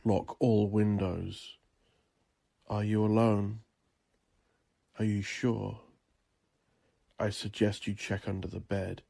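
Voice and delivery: ordinary delivery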